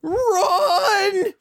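panicked. Retold or imitated. Spike panicking.

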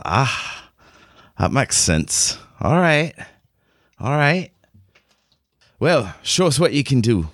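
lightly put-on Scottish voice, granting a chance; appraising Scottish commander. Game master as Merida challenging the newcomer to show off, appraising character voice.

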